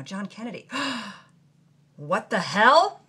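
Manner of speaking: ordinary delivery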